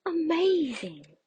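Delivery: storybook wow; amazed. A narrator's reading. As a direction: burst with delight. Narrator exclaims.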